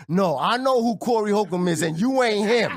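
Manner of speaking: dismissive bouncer. Retold or imitated. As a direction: imitate a bouncer turning someone away. Acts bouncer rejecting him.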